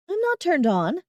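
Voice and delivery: high, shaky denial. Acted. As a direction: say it with a tremble. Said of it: "I say in a shaky voice".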